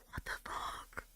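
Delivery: barely voiced whisper of disbelief; shocked whisper. Real, not acted. Streamer whispering to chat after the story, shocked whisper. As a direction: breathe the words in shock.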